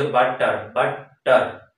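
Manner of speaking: বাটার in two separate beats, বা-টার; teacher's split demo of বাটার; syllable by syllable. Shown as a demonstration. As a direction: sound out বাটার with a gap inside. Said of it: "দুইটা syllable থাকবে".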